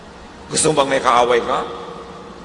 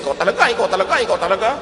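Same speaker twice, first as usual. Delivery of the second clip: raised, nagging scold; a touch of an annoyed neighbour, pitched up; mock scolding; 6 semitones higher, much faster than usual. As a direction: wag a finger and nag. Angry neighbour scolding you.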